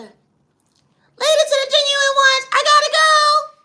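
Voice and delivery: high, slow, loud put-on voice; high sign-off voice. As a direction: stretch the goodbye out high and loud.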